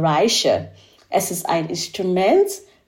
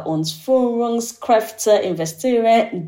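normal talking voice

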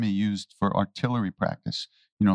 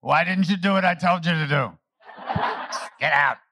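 half-laughing scolding, louder and higher; mock scolding; 7 semitones higher, 3 dB louder than usual. Maharaji scolding the devotees with a laugh. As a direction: scold them like a laughing guru. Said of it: "if Maharaji could ask a question".

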